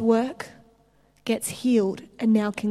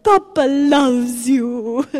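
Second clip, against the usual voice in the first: much louder, slow and drawn out; booming Papa loves you; 3 semitones higher, 10 dB louder, much slower than usual. Retold or imitated. Leif Hetland preaching to the crowd, booming and slow. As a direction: boom it out like a big-hearted preacher. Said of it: "He's like".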